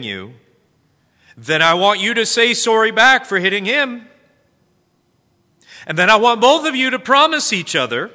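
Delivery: put-on loud, high parent refereeing the kids; exasperated parent. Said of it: "turning to the back seat to try to solve the problem".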